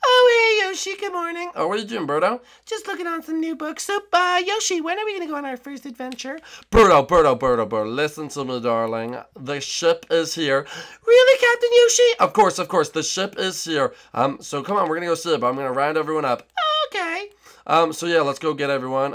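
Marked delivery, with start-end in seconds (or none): 0.1-1.5 put-on high sweet girly voice; Birdo voice. To Yoshi in the morning, high sweet Birdo voice. 2.6-6.4 put-on high sweet girly voice; Birdo voice. To Yoshi in the morning, high sweet Birdo voice. 11.0-12.2 put-on high sweet girly voice; Birdo voice. To Yoshi in the morning, high sweet Birdo voice. 16.9-17.3 put-on high sweet girly voice; Birdo voice. To Yoshi in the morning, high sweet Birdo voice.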